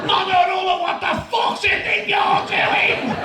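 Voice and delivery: high, loud, outraged Scouse shout; Scouse teacher. Retold or imitated. Scouse teacher shouting up at the defiant pupil, outraged Scouse bellow. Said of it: "Mister Townsend with his Scouse voice".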